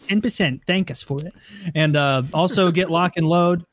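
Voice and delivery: ordinary delivery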